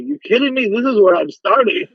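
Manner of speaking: mock outrage at his mother's demand; retold indignation. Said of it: "And I got so mad".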